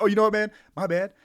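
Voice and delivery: high, loud put-on apology; playful mock apology. Teasing the guy behind in line, playful mock apology. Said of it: "Let me play with this guy".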